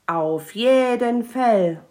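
loud, slow, drawn-out agreement; emphatic dragon. Acted. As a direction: boom it out like a proud dragon.

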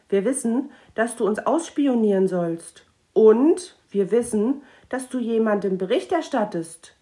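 corner the spy, pressing harder each line; girl confronts the gnome sternly; stern confrontation. Acted.

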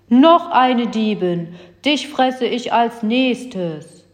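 slow, heavy giant threat; menacing giant. Acted. Giant threatens the new thief, slow and menacing. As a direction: loom over them, drawing it out slowly.

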